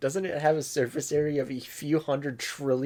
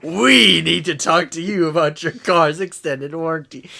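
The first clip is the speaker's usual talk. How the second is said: loud announcement; 3 semitones higher, 7 dB louder than usual. Pretend. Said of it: "We say in unison".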